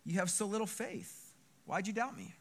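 hushed, soft mild reproach; gentle questioning. Acted. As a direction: ask it softly, with no scolding. Jesus gently questions rescued Peter.

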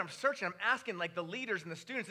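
ordinary delivery

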